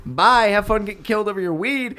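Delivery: high, singsong mock goodbye; retold breezy kiss-off, pitched well up; sarcastic farewell. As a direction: toss off a biting bye as you leave.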